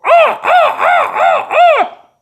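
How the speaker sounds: very high, loud drawn-out caws; crows cawing. Acted. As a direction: caw like crows scattering. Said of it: "and the crows go".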